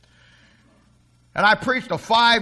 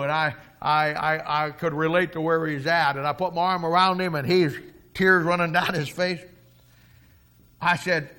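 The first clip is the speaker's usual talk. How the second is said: ordinary talk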